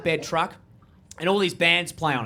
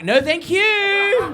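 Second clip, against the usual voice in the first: high, loud, stretched-out refusal; sing-song it high and drag out the last word; mock squeal; 10 semitones higher, 5 dB louder, much slower than usual. Festival kids turning down the uncle, high drawn-out mock squeal.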